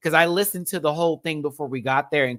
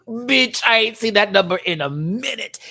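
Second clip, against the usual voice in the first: excited; 10 semitones higher, 7 dB louder than usual. Real, not acted. Thrilled about low power bill.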